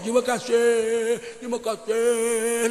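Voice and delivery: slow, humble bowing thanks; lightly voiced beggar thanking over and over; a beggar bowing as he thanks for five thousand; grateful beggar. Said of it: "terbungkuk-bungkuk dia".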